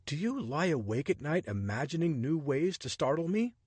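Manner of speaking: a hint of a startled man grumbling; Richard, exasperated. Richard's dialogue voiced.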